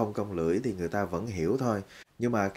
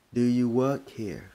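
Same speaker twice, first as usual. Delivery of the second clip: slow, careful English question; slow demo; 3 semitones higher, 4 dB louder than usual. Shown as a demonstration. Teacher showing learners a shop question slowly. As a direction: model it slowly and clearly. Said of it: "Thì cái câu đó sẽ nói là".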